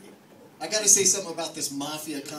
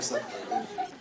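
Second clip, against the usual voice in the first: squeaky aside. Acted. Squeaky punchline.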